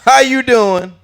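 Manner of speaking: loud, high comedian impression; Bernie Mac. Comic orders at McDonald's, loud high impression. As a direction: blare it as a brash comic. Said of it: "Bernie Mac going to McDonald's and ordering".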